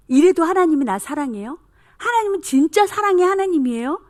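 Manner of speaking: put-on high, loud testing questions from a child; insistent challenge. Defiant children testing whether God loves them, insistent challenge.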